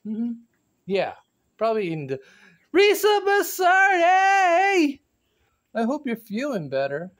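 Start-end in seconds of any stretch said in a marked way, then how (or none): 2.7-5.0 slow, loud, high called name; shout-out. Acted. Vlogger giving a viewer a shout-out, slow and loud. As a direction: stretch the name out in a big call.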